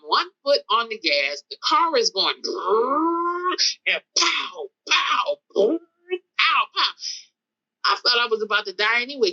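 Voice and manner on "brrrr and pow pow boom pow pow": long revving brrr, then popping bangs; imitated car noises. Car revving with foot on gas, imitated noises. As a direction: voice the engine and the bangs.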